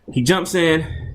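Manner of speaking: usual speaking voice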